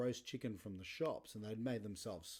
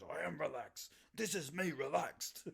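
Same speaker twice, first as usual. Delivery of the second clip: tense dad insisting; 6 semitones higher, 4 dB louder than usual. Retold or imitated. Dad answering his son, insisting he's relaxed while tense. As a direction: insist you're calm while clearly wound up.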